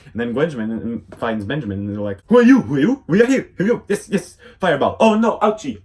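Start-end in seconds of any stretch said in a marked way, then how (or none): 2.3-5.8 much higher, louder cartoon squeals; squeaky excited voices. Cousins shrieking at the fire alien, excited. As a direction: shriek the lines like a cartoon kid. Voicing Gwen and Max confronting.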